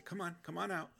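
lightly voiced patient savior inviting out; gentle Jesus. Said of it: "then Jesus comes back the next day".

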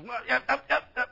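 high-pitched comic yelps; short, high mock yelps; mimed yelping. Preacher acting out hearing the Holy Spirit, comic high yelping.

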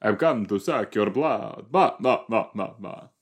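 hammed-up Transylvanian vampire voice; Transylvanian accent. Host mocking a blood-sucking vampire, Transylvanian accent. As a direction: do a corny Transylvanian Dracula, ending in silly blahs. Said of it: "Okay, Transylvania, right?".

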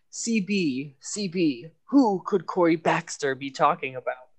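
lightly voiced cartoon villain; a hint of scheming villain. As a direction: puzzle it out like a mild cartoon schemer. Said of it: "your cartoon villain line".

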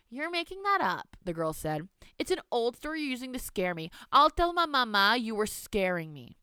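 put-on high, defiant little girl voice; defiant child. Girl defying her grandmother, high bratty voice. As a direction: talk back like a bratty child.

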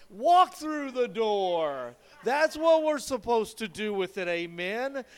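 fervent sermon delivery, punching the key phrases; impassioned preaching. Real, not acted. Preaches at full force.

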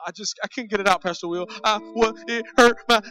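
high, hammed-up tearful stammer; mock crying. Crier telling Pastor Will what hurt, mock sobbing. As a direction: imitate blubbering through broken words. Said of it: "try to talk to you while they're crying".

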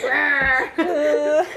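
loud playful monster roar; imitated roar. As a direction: roar like grandpa playing monster. Grandfather chasing kid as monster.

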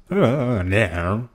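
high drawn-out "uh", then Aussie "naur"; mock Australian accent on "no". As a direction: imitate an Australian woman's hesitant "naur". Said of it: "talking with this Australian woman".

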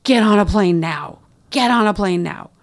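half-strength re-enacted scream; retold scream. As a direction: imitate a frantic mother's urgent command. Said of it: "she's screaming".

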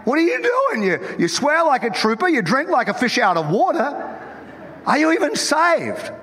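high-pitched, incredulous scolding; mock scandalised. Preacher retells confronting his friend, mock scandalised. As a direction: act out appalled questioning, pitch leaping up.

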